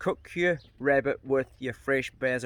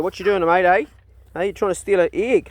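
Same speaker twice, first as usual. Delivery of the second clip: loud, higher-pitched dog talk; playful accusing; 6 semitones higher, 11 dB louder than usual. Real, not acted. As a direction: call out to a dog caught in the act. Catches dog after an egg.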